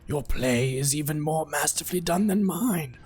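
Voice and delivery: dark herald addressing his rival; sinister cosmic lord. Acted. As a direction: speak as an ancient villain savouring victory. Prince and leper voiced.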